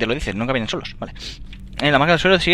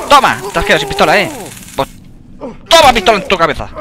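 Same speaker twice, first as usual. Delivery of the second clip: loud, higher triumphant jeering; gloating taunt; 6 semitones higher, 9 dB louder than usual. Real, not acted. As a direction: rub it in his face. Se burla tras vencer. (burla triunfal a gritos)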